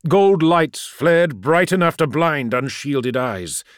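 ordinary delivery

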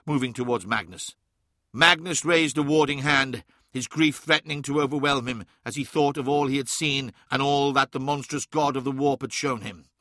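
usual speaking voice

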